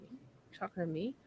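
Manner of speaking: soft, hushed puzzled quip; a confused airman asking back. Retold or imitated. Random airman puzzled at O'Neill, hushed. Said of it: "looks at O'Neill like".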